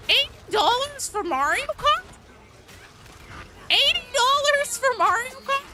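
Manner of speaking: high, loud incredulous ranting; loud, shrieked indignant question; outraged yelling. Real, not acted. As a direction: shout it in disbelief at the price. Outraged rant about game price.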